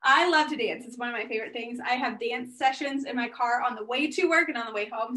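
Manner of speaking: ordinary talk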